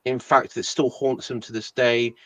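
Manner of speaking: ordinary delivery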